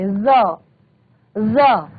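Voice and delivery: ज said as a buzzing z; nukta z sound. Shown as a demonstration. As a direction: voice ज as z, held out slowly. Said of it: "इसको क्या पढ़ते हैं".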